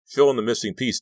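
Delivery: pleading shout. A narrator's reading. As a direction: demand it, desperate. Son begging father for answers.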